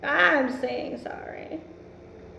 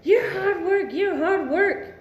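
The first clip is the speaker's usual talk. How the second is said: mock accusation; 6 semitones higher than usual. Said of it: "He wants to tell you".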